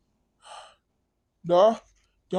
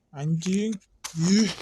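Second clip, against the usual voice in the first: normal talking voice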